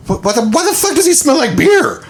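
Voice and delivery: very high, stunned squeal; shocked mom. Retold or imitated. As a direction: mom catching the beer smell, squeaking.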